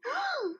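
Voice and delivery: high dramatic gasp; sharp gasp. A narrator's reading. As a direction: gasp in mock horror. Pinkie horrified thought.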